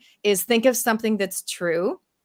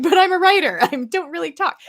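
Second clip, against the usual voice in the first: higher, louder protesting voice; mock protest; 11 semitones higher, 6 dB louder than usual. Author objecting to public speaking, in mock protest. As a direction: imitate a reluctant author objecting.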